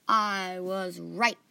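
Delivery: proved right, gloating enthusiastically; enthusiastic. A narrator's reading. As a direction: savor being proved right. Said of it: "Archie said enthusiastically".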